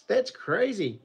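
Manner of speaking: ordinary delivery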